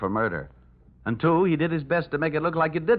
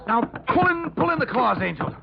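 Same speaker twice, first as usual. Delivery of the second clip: high, raised voice mid-struggle; urgent restraining order; 13 semitones higher than usual. Acted. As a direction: order her off while grappling. Restraining an attacking woman.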